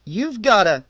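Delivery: loud stern start, broken off; stern order cut short by a punch. A narrator's reading. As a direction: clerk cut off mid-warning. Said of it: "he said sternly".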